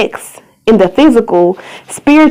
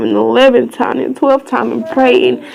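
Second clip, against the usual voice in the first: usual speaking voice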